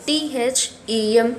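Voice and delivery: letter by letter, T H E M; spelled T H E M for the class. Shown as a demonstration. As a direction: spell the word them one letter at a time. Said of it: "You have to write in this one them".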